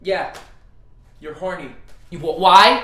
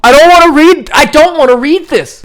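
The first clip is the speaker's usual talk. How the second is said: loud complaint; 6 semitones higher, 7 dB louder than usual. Real, not acted. Reluctant outburst at smut.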